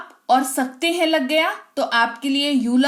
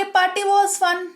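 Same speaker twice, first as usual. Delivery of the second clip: slow, lifted model line; 8 semitones higher, much slower than usual. Shown as a demonstration. Teacher models the English phrase.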